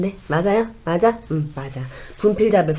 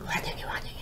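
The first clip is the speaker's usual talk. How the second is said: spirit whisper; 16 dB quieter, faster than usual. Acted. Shaman relays grandmother's spirit.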